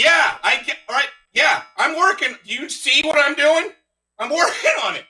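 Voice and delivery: high, exasperated night-shift worker; harried shift leader. Acted. Fast-food shift leader at two A M, harried. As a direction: snap back high-pitched, overworked and defensive. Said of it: "shift leader at a Jack in the Box at two A M".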